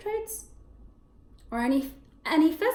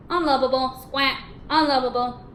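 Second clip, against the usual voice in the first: squawking parrot, louder; 7 dB louder than usual. Acted. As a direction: caw it out like a parrot. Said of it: "taught the parrot to say".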